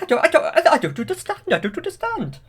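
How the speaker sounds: high, loud baffled blustering; confused king. Retold or imitated. Hubert splutters at the couple in high confusion. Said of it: "he's like".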